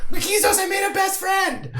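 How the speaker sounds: high, loud, laughing shout; excited shout. Acted. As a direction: proudly holler the news to a crewmate. Excited announcement to crewmate.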